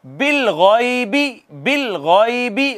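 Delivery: slow, drawn-out chanted recitation; Quran recitation. Shown as a demonstration. Teacher reciting the verse for students, slow chanted recitation. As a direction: recite each word slowly, stretching the vowels. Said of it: "teacher recites for students".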